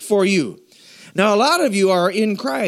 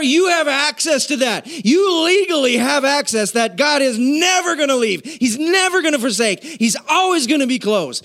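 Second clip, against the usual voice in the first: full-force preaching; 8 semitones higher than usual. Acted. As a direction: proclaim it with fire to the congregation.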